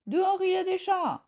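high, loud warning; alarmed father bird; warning cry. A narrator's reading. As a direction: warn him urgently. Father warns of cats.

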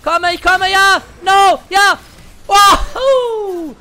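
high, loud, yelling bursts of triumph; excited shouting. Real, not acted. Streamer yelling as he one-shots Soraka, loud excited shouts. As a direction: whoop it out as the kill lands. Winning a teamfight kill.

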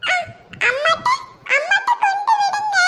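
put-on little boy begging grandma to take him to mum; crying toddler. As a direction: whimper like a small boy. Toddler asks to be taken home.

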